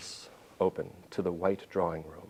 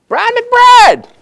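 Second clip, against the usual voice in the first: loud, high star-struck yell; a tipsy fan's booming greeting; excited fan shout; 22 dB louder than usual. Retold or imitated. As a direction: shout his name like a thrilled fan. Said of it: "he looks at me and goes".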